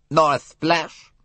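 a sly little Belgian detective; Poirot's voice. A narrator's reading. Poirot probing the suspect, light foreign voice.

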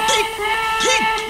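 high short whistle; whistle peep. Acted. Edward getting an idea, high whistle peep. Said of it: "he whistled".

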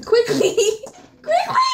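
high and laughing, urgent. Real, not acted. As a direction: beg for it through laughter. Burning eyes, laughing.

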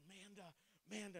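whisper-call to the wife lying beside him in the dark; nudging a sleeping wife awake with his voice; hushed call. Retold or imitated. Waking wife in dark bed.

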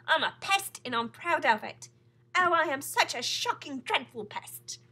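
loud, high, gleeful boast; happy cry. A narrator's reading. Centipede boasts of being a pest, loudly delighted. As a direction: crow it proudly, dragging out the last words. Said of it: "cried the centipede happily".

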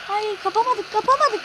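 giderek yükselen tiz sitem; artan çaresiz bağırış. Real, not acted. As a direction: her tekrarda daha yüksek bağır. Topu kaybedince yükselen sitem. (topu kaçırınca giderek yükselen bağırış)

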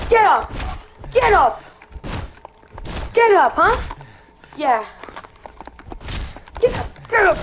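shouting get up. Real, not acted. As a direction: yell at a friend down on the ground. Yelling at fallen friend.

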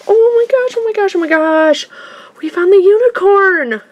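high, rapid excited gushing. Real, not acted. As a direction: babble with real delight. Thrilled by rare toy find.

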